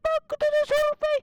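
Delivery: high, excited cartoon voice all through; squeaky put-on voice. Kid gamer in a high silly voice reacting to the fish. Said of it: "miksi mä puhun tuolla äänellä".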